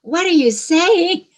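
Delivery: high, loud, incredulous questioning; a skeptical inner voice challenging the speaker; inner doubting voice. Retold or imitated. The inner voice questions the preacher, high and loud. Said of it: "a little voice here going".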